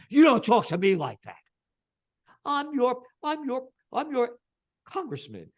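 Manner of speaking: confronting a congressman, furious; acted angry confrontation. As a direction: bark it back at him. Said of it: "Go down like that".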